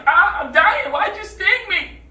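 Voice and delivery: loud, high wail of a stung frog; high, loud, comic death protest; mock dying outcry. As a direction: cry out in hammed-up agony.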